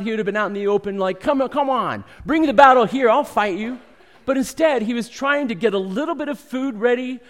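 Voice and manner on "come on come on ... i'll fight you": high, loud mock battle cry; bold warrior challenge. Preacher imagines a strong man of God calling for battle, loud and high. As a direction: call the enemy out like a brash fighter. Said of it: "If he was really that strong man of God".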